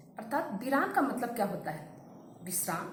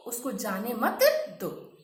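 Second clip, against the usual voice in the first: normal talking voice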